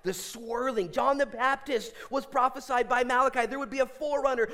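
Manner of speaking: ordinary delivery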